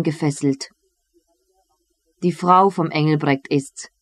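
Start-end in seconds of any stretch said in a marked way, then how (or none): none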